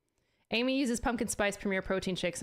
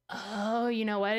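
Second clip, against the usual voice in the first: ordinary talk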